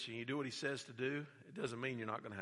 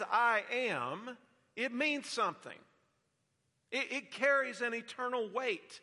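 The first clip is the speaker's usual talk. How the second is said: higher, louder fervent preaching; full-force preaching; 10 semitones higher, 6 dB louder than usual. Acted. Pastor proclaiming Jesus's I am sayings, fervent. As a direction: lift it to the back pew.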